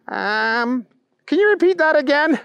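high, dim-witted question; hesitate, then ask in a high voice; clueless Booger. A narrator's reading. Booger asking the ranting bunny to repeat, high and clueless.

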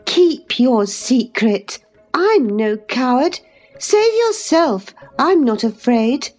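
put-on high, deliberate young lady's voice; scornful compassion. A narrator's reading. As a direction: reassure him with lofty, pitying scorn. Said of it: "said Catherine with scornful compassion".

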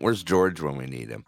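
usual speaking voice

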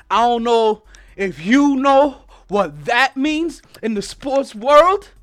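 hype host. Acted.

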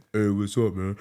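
low giant's laid-back voice; imitating Shaq. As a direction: drop into a big man's easy rumble. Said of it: "And he's like".

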